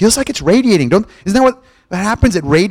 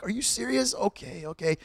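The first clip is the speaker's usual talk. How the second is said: much softer, deflated dread as he gives in; resigned dread; 3 semitones higher, 14 dB quieter than usual. Retold or imitated. Patient gives in to the dreaded blood draw, softly deflated. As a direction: let it sink in with a soft defeated sigh of words. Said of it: "I'm like".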